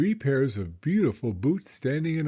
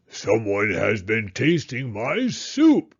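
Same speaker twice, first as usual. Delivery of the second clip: louder, slow big bear voice; roaring Papa Bear; 3 semitones lower, 5 dB louder, slower than usual. Acted. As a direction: roar it like an indignant papa bear. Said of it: "he roared".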